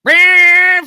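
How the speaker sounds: loud, high mock shout; hyped shout. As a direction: yell like you're redlining it. Redlining the lawnmower car.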